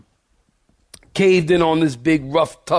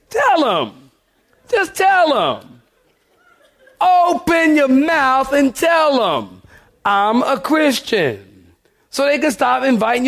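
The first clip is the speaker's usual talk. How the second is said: much higher, slow preacher's exhortation; fervent urging; 9 semitones higher, slower than usual. Real, not acted. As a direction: plead with them to speak up, drawn out.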